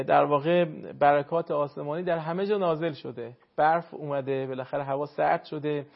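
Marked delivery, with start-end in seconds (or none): none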